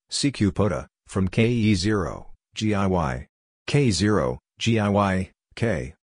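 ordinary delivery

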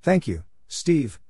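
ordinary talk

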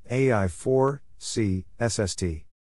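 ordinary talk

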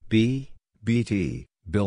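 ordinary talk

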